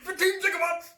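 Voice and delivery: very high, loud film-quote cry; Back to the Future impression. Quoting the movie for laughs, high frantic impression. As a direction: exclaim it like a frantic movie character. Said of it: "I've been watching Back to the Future".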